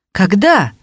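pert, challenging question; feisty retort. A narrator's reading. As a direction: fire the word back cheekily. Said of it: "с задором переспрашивает Фетченко".

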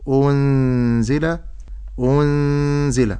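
أنزل drawn out slowly, nun hidden into the z; slow recitation of أنزل with nasal ghunna; ikhfa demo. Shown as a demonstration. Teacher modelling the hidden nun for a student. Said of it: "أقول".

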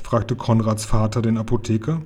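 plain reading voice

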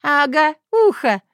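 high, loud gleeful cackle; sinister cackle. Acted. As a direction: cackle it out wickedly. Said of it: "зловеще захохотала".

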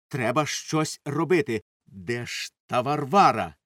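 plain reading voice

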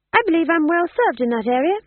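high-voiced, smooth polite refusal. A narrator's reading. Tom John politely declining the thieves' offer, high and smooth. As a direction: decline smoothly, pitched well up. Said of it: "said Tom John smoothly".

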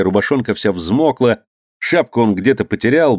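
ordinary delivery, nothing marked

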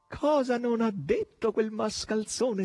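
high, drawn-out shrill exclamation; shrieking gleefully. A narrator's reading. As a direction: squeal it slowly with relish. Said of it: "strillava e rideva Natascia". (strillo acuto e divertito)

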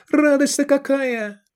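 sehr hohe Greisenstimme, entzückt; freudiger alter Mann. A narrator's reading. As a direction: ein Alter strahlt vor Freude. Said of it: "воскликнул он".

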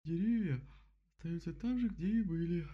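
yawned through, high and drowsy; yawning sleepy bear. Acted. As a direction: say it mid-yawn, sleepily. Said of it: "зевнула Мишка".

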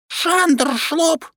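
very high little creature voice; squeaky creature. Acted. Shnyrka answers as he appears, very high creature voice. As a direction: chirp it like a tiny magic beast.

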